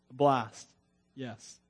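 ordinary talk, nothing marked

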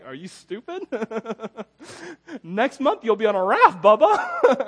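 laughing, rising to a loud high jeer; mocking laugh. Cubans mock him, laughing.

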